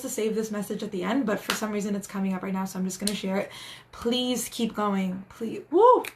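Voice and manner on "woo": a sudden high, loud whoop; excited cheer. Real, not acted. Spiritual reader whooping as her message lands with viewers. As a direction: let out a joyful whoop of release.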